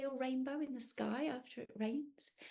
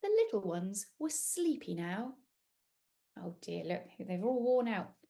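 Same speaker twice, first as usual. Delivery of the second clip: usual speaking voice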